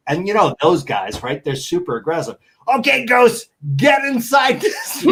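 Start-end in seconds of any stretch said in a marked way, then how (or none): 2.7-5.1 high, loud mock yell at a ghost. Hosts yelling at a ghost down the hallway, mock yell. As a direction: bark orders at an invisible ghost, laughing through it. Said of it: "they're yelling at whatever ghost".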